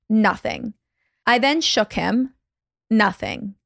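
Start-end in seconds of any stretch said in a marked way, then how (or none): none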